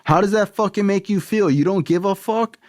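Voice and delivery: high, mock-furious dad rant; dad screaming. Dad yelling at his son for taking his last forty dollars, furious. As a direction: rage at the son who stole his money. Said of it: "he'd be screaming".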